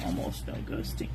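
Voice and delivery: whispered self-correction. Real, not acted. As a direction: correct yourself under your breath. Muttered correction to himself.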